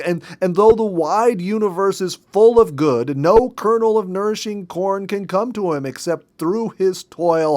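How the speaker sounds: wife reading Emerson aloud, determined not to be drowned out; reading with added power. Acted. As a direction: push the words out firmly, refusing to be interrupted. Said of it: "added power to her tones".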